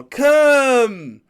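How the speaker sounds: loud, high showy beckoning; loud high hammy calling; hammy Hugh Jackman. Retold or imitated. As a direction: call fans over like a ham actor. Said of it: "Hugh Jackman's all".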